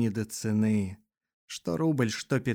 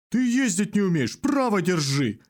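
angry; 7 semitones higher, 3 dB louder than usual. A narrator's reading. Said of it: "сердится военный".

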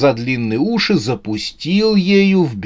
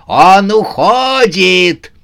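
high, drawn-out alarmed yell; 7 semitones higher, 6 dB louder, much slower than usual. A narrator's reading. Сюзанна watches Новый год escape, yelling. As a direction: holler that the quarry escapes. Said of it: "заорал".

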